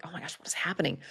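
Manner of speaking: breathy, half-whispered retold shock; soft, stunned aside; hushed alarm. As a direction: whisper it in mock panic. Retold private alarm at friends marrying.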